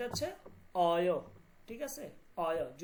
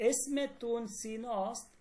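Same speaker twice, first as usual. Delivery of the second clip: slow, careful teacher demo; drawn-out এসমে তুন সিনস্ত for learners; slow model phrase; much slower than usual. Shown as a demonstration. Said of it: "দুনিয়া বলতেছে". (ধীরে স্পষ্ট করে উচ্চারণ)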